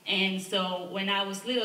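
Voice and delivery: normal talking voice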